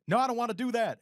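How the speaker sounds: high whining mock dog voice; high-pitched imitation of a reluctant pet; protesting dog. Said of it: "you can tell the dog's, like, wailing".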